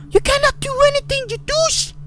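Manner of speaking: very high, fast mocking squeal at the ghost; mock-squeaky bully voice; squeaky taunt. Real, not acted. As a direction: squeal a taunt right at the monster.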